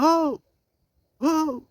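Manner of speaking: high, drawn-out hollering "oh"; mock shout from behind; acted calling out. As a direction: holler after people getting away. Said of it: "ele vai gritando atrás".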